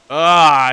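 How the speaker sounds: sudden loud frustrated "ah"; frustrated yell. Real, not acted. Gamer annoyed at sabotaging himself, loud yell. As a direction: blurt out a loud groan of annoyance. Frustrated outburst at own mistake.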